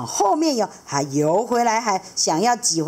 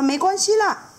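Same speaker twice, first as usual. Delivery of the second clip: much higher, firm refusal; grumpy stonefish; 4 dB louder than usual. Acted. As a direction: shoo him away, loud and high. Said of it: "石头鱼就说".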